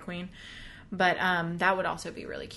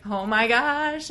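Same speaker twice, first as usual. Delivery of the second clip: slow, drawn-out delighted gasp; excited kid; 4 semitones higher, 7 dB louder, much slower than usual. Retold or imitated. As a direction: stretch it out like a thrilled kid. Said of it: "Emmeline, she was like".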